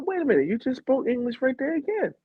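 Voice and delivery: high-pitched baffled protest; heightened mock incredulity. Traveler challenging the woman who denies English, high and incredulous. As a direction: squeal the objection in mock disbelief.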